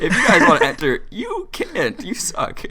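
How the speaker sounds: laughing while teasing; mock the line through laughter; laughing mockery. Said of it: "mocking his announcement".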